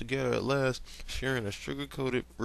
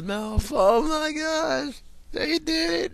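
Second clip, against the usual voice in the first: high, loud fangirl squeal; thrilled high squeal; excited squeal; 7 dB louder, slower than usual. Real, not acted. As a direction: shriek with delight at the ship. Fan squealing at the kiss.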